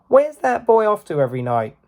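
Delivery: a hint of a worried mother. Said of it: "said his mother".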